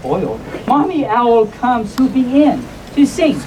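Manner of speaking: ordinary delivery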